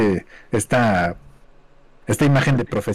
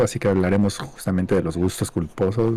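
ordinary talk, nothing marked

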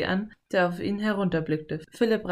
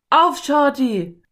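loud, high, drawn-out rallying cry; slow, carrying command shout; urgent call; 8 semitones higher, 11 dB louder, slower than usual. A narrator's reading. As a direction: shout the horse into motion, stretching it out. Cowboy calls out during escape.

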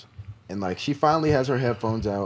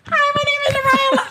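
absurdly high squeaky fake voice, louder; silly fake voice; 8 dB louder than usual. Shown as a demonstration. Telling friends his pickup theory, in a squeaky fake high voice. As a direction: squeak the intro way up high to get a laugh. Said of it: "that's not even my real voice".

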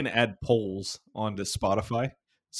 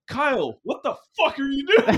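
high-pitched mock mom outcry; appalled mom; 6 dB louder than usual. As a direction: imitate a mother's loud, shocked scolding. Mom discovers broken door, retold.

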